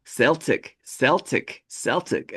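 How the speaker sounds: imitating a Scot saying SEL-tic; Scottish woman quoted. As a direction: say Celtic as SEL-tic, each one separate. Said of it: "Scottish accent. And she said".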